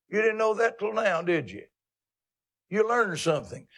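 usual speaking voice

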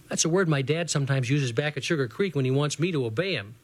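usual speaking voice

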